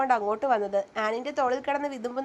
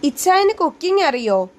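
loud, high-pitched astonished question; surprised burst, voice raised; amazed; 4 semitones higher, 8 dB louder than usual. A narrator's reading. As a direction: ask in wide-eyed disbelief. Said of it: "Annmary അത്ഭുതത്തോടെ നോക്കി".

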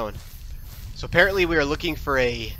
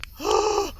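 high gasp of fright; startled gasp; 3 dB quieter than usual. Real, not acted. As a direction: gasp at a sudden scare. Scare in horror game.

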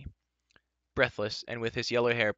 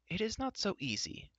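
higher, much softer warning; warned; 11 semitones higher, 6 dB quieter than usual. A narrator's reading. Adon cautioning Terran, softer and grave. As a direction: caution them gently. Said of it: "Adon warned".

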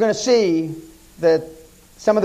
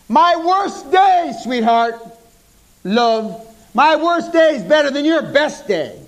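smug retort; 8 semitones higher, slower than usual. Pretend. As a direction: gloat over the poor interviewer. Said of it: "what I would have said was".

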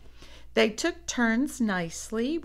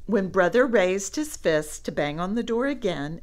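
ordinary delivery

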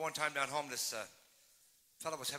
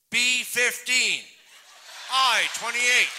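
loud, slow bingo call, laughing through it; bingo caller; 8 semitones higher, 13 dB louder, much slower than usual. Acted. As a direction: call the numbers like a bingo hall, slowly.

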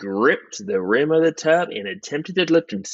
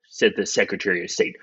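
ordinary delivery, nothing marked

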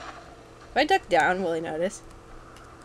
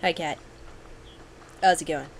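normal talking voice

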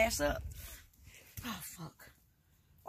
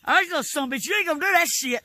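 louder, heated warning; angry warning; 4 semitones higher, 6 dB louder, faster than usual. Real, not acted. Grandma warning her grandson angrily. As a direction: warn him hotly.

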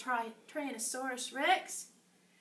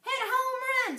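cheering; 11 semitones higher, 4 dB louder than usual. Acted. Dinosaur cheering at game.

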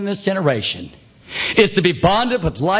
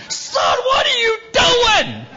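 high, strained cry of alarm; father shouting in fear for his son; much slower than usual. Retold or imitated. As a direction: yell at the boy in panic. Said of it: "faster than I've ever moved".

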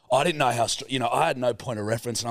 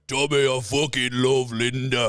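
Peaky Blinders impression; slower than usual. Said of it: "I can do Tommy, Arthur, and Alfie".

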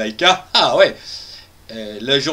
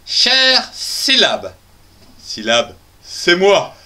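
reading aunt's letter; much slower than usual. Acted. Host reading his aunt's letter aloud, slow and formal. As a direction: read the opening like a stately letter. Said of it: "voyons la lettre de ma tante Agaf".

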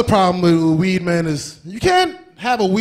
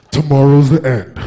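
doomsday voice; 6 semitones lower, 5 dB louder than usual. Retold or imitated. Repeats doom line.

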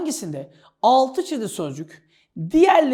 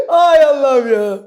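loud sigh after laughing; loud exclamation; 2 semitones higher, 10 dB louder than usual. Real, not acted. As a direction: cry it out, winding down from a laughing fit. Recovering from laughing fit.

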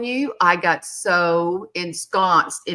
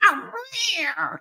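pitiful whimper; 10 semitones higher, 5 dB quieter than usual. Retold or imitated. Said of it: "It was pitiful".

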